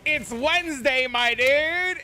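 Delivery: high, loud "It's Wednesday, my dude"; meme voice. Retold or imitated. Dad teasing his son at breakfast with a meme. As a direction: say it like the frog meme. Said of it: "I was like".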